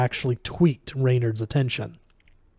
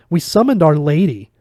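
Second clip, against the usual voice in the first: higher, small-voiced protest; raised, plaintive little voice; 4 semitones higher, 7 dB louder than usual. A narrator's reading. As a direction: protest like a small child, pitched up. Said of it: "Asmo said in a small voice".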